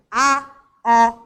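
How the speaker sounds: A and O voiced loud and full; strong vowel sounds. Shown as a demonstration. Teacher showing pupils the stronger vowel sound, loud and isolated. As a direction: belt out each vowel, "A", "O", separately. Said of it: "tem um som mais forte".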